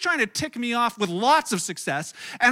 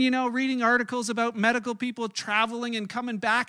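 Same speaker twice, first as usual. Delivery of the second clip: ordinary delivery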